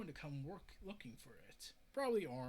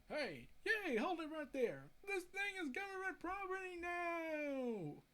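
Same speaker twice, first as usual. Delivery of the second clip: higher, slow officious voice; sheriff's claim; 10 semitones higher than usual. Retold or imitated. Sheriff seizing the creature from Aquaman, in a higher, slower officious voice. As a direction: lay down the law like a pompous sheriff.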